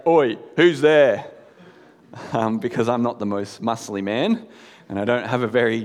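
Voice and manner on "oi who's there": louder, booming fake tough-guy voice; big deep voice. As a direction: put on a big voice to scare off an intruder. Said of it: "with a big, deep voice".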